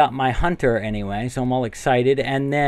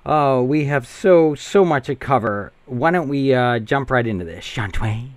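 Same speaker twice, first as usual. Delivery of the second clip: ordinary talk, nothing marked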